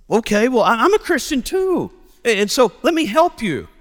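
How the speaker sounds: high, eager acted reassurance; eager common ground. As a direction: offer help eagerly, voice lifted. Stranger finds they share faith.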